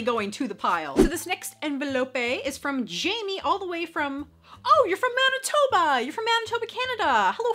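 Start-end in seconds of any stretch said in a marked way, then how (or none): 4.6-7.5 high, excited exclamation; light up at a fellow Canadian's letter; happy surprise. Real, not acted. Surprised by the sender's home.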